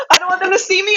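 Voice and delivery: high, loud mock panic. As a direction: squeal it like you're hiding. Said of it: "I'll just be like".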